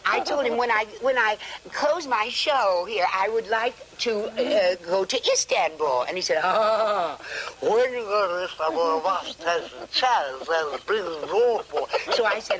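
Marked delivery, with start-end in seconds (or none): 6.4-11.6 upper-class English with teeth gritted, mumbled and clipped; long tooth accent. Retold or imitated. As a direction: mumble it posh through long front teeth. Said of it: "Long tooth accent".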